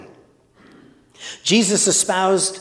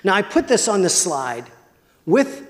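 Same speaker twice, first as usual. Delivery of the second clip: ordinary talk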